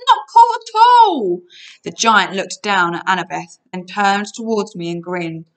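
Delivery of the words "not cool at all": long, high, loud yell; protesting yell. A narrator's reading. Grover tied to the vibrating bed, drawn-out yell. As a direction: yell it slowly at a high pitch. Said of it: "he yelled, his voice vibrating".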